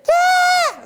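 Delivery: very high shrieking yell; Elmo-ish yelp. Retold or imitated. Falling off the ledge, a very high Elmo-like yell. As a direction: squeal like Elmo. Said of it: "a bit Elmo-ish".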